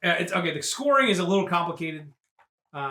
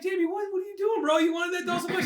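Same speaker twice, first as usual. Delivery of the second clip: high whiny voice; 11 semitones higher than usual. Retold or imitated. Arnold asks the kid.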